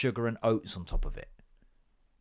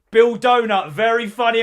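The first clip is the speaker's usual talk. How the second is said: sarcastic; 9 semitones higher, 10 dB louder than usual. Retold or imitated. Voicing Bill's sarcastic reply.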